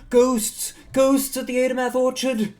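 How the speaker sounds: high, very loud alarm cry; panicked townsperson shouting. Acted. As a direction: shout a warning down the street. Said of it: "just shouting".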